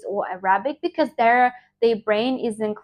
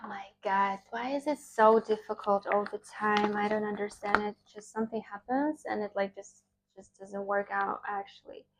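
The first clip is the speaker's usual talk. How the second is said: sigh, then grumble softly to yourself; real frustrated aside, very soft; weary exasperation; 8 dB quieter than usual. Real, not acted. Grumbling over failed takes before the intro.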